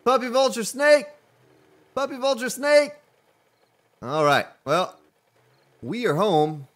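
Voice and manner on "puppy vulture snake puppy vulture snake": loud and high, calling out the creature; startled game shout; excited shout. Real, not acted. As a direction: yell it out in surprise.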